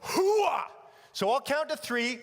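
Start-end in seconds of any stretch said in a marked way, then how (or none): 0.0-0.6 guttural soldier shout. Shown as a demonstration. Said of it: "it's gotta be guttural".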